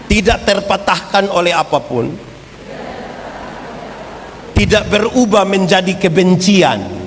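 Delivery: liturgical call to repeat; recitation. Acted. Leads recited creed.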